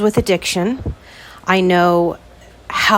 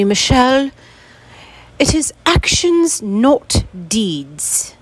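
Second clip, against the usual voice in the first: higher-pitched playful English accent imitating her dad; 6 semitones higher, slower than usual. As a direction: put on a London English accent to quote dad. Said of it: "I'm gonna try and do an English accent".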